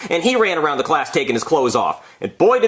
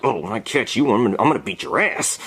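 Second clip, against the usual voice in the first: muttered threat; 5 semitones lower than usual. Acted. Acted threat to the kid.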